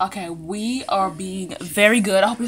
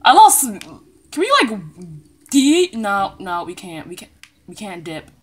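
ordinary delivery

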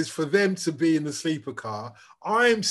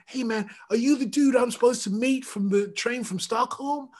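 put-on American accent; 7 semitones higher than usual. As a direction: play it like a cool American musician looking for his contact. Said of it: "talking in an American accent".

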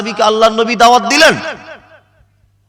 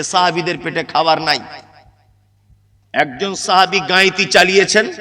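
normal talking voice

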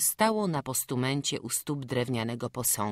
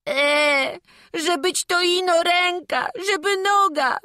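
high, wailing complaint; acted bawling; 9 dB louder than usual. Boy answers whether he broke a limb, bawling. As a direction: blubber the words as an upset boy. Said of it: "na górze i beczy".

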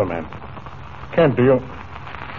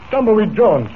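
high, louder delighted call; surprised greeting; 6 semitones higher, 3 dB louder than usual. Acted. Comanche greeting Tumbleweed at the ranch house, surprised and loud. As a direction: hail an old friend, surprised.

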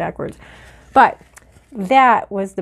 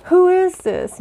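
high, slow puzzled question; high drawn-out puzzled question; mock puzzled; 10 semitones higher, 5 dB louder, slower than usual. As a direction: wonder aloud who sent it.

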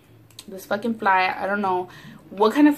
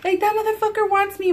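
much higher frantic plea; high frightened plea to boyfriend; panicked; 12 semitones higher than usual. Retold or imitated. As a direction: squeal for help to your man. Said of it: "I tell him".

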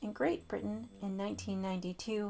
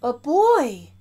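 startled yell; 2 semitones higher, 11 dB louder than usual. Acted. The bear sees Eddie and yells in fright. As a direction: startle at the sight of a boy. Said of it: "yelled the bear".

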